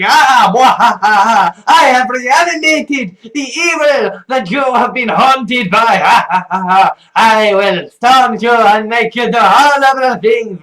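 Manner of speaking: loud, hammed-up evil villain with cackles; cackling villain. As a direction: ham up a gloating movie villain. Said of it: "the cackling villain going".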